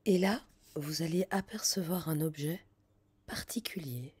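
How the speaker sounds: guided visualization. Acted.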